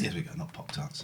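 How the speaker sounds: whispered aside. Real, not acted. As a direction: breathe it, no voice. Aside correcting himself.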